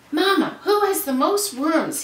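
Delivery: put-on high, loud eager boy; bursting to know, calling to mama; eager child's question. A narrator's reading. Young boy calling eagerly to his mama.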